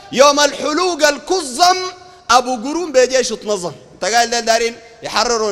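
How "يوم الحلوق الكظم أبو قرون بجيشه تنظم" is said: slow opening, drawn out on the rhyme; raised, stretched line easing into rhythm; poem recital. Acted. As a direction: declaim the quoted verse. Repeats the poem emphatically.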